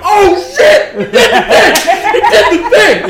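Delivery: very loud, high excited yell; high-pitched, roaring amazement; amazed crowd shouting. Acted. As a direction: shout it like thrilled spectators going wild. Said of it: "Very much like".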